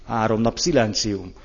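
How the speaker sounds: normal talking voice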